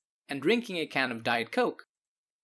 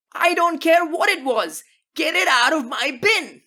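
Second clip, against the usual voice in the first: furious shouting; 11 semitones higher, 7 dB louder than usual. Acted. Homeowner screaming at teen to take his trash, furious. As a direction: lose it completely over a soda can. Neighbor keeps yelling.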